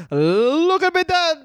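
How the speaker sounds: very high, cartoon Italian accent; hammed-up mock Italian, held high; Dominic Tonucci. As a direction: play an excitable Italian wrestling trainer. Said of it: "My name is Dominic Tonucci".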